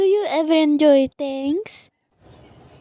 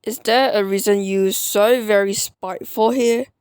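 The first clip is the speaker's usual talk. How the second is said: normal talking voice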